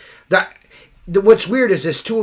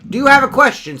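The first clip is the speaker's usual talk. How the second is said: mock polite challenge; 9 semitones higher, 8 dB louder than usual. Confronting a driver.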